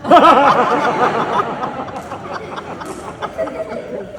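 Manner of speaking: loud, high delighted laugh; big laugh. Real, not acted. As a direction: burst out laughing. Laughs at son's joke.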